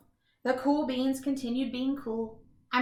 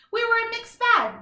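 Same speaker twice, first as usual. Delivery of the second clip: much higher pitched; higher playful narration; bright lift; 10 semitones higher, 6 dB louder than usual. A narrator's reading. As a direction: pop the phrase up high. Lively storytelling phrase.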